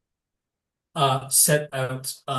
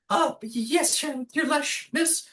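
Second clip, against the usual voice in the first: high, stammering little goblin voice; nervous goblin servant voice; timid goblin servant; 13 semitones higher than usual. Acted. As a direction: squeak out a flustered reply to the Lesh.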